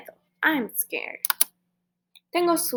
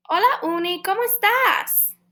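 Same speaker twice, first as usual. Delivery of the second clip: lilting high hello; high playful call to the unicorn puppet; bright high greeting; 9 semitones higher than usual. Acted.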